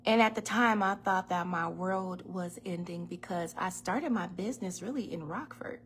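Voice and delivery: ordinary talk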